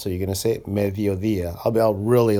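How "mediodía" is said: both D's in "mediodía" softened to a th sound; soft D between vowels. Shown as a demonstration. Teacher showing learners the word for noon, soft D's. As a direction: say "mediodía" with the tongue between the teeth for each D. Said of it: "both D's are in between vowels".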